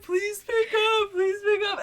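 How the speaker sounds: high, slow pleading through tears; tearful begging. Retold or imitated. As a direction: plead desperately, voice way up and crying. Begging boyfriend to answer phone.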